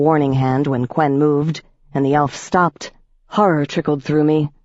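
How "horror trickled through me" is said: plain reading voice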